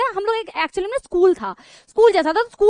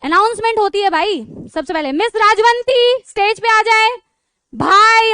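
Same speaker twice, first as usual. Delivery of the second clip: higher, louder, slow announcer call; a teacher hams up the vaccine-centre announcer; mock mic announcement; 5 semitones higher, 5 dB louder than usual. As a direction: play the tannoy calling names out slowly. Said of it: "mic से announcement हो रही है".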